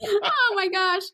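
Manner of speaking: high, stretched-out words on the tail of a laugh; laughing exclamation. Real, not acted. Actor recalling a riot of a shoot, laughing. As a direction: let the laugh lift it, slowly.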